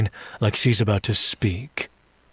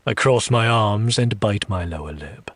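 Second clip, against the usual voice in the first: ordinary delivery, nothing marked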